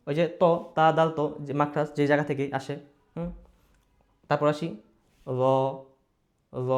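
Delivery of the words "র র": "র" as full-mouthed Arabic Za, tongue tip at upper teeth; tongue-tip letter Za. Shown as a demonstration. Teacher modelling the letter, "র" from tongue tip and teeth. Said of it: "জিহ্বার আগা, দাঁতের আগা".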